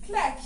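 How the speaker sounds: short, loud vocal snap; sharp "clac". Shown as a demonstration. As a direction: mark the hip accent with one hit. Dance teacher voices the accent.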